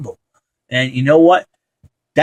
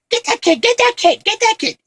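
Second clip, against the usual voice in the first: very high, squawky repeated order; frantic cartoonish crook's squeal; Joe Pesci. Film fan imitating a Home Alone burglar, high-pitched. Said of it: "Joe Pesci's just funny in that one".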